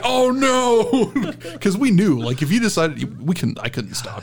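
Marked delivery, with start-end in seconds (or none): 0.0-1.3 high laughing "oh no"; laughing mock alarm. Coworkers reacting to angry Thomas, laughing alarm. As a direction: squeal it through a laugh, pitched up.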